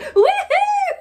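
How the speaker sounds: high, loud cheer; mock whooping. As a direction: imitate an over-eager whoop, laughing. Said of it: "she's not a woo kind of girl".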